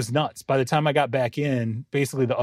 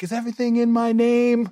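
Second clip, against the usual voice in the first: mock yell; 8 semitones higher than usual. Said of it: "I could hear her yelling".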